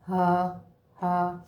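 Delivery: ہا breathed out from the chest. Shown as a demonstration. Teacher showing pupils ہا from the chest. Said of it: "سینے سے ایسے ہا".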